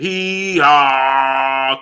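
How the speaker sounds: long stretched "hee haw" holler; Calvin Ford. Retold or imitated. Streamer redoing the chat's joke, imitating Calvin Ford's drawn-out holler. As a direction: hold both syllables, imitating his call. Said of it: "Calvin Ford style".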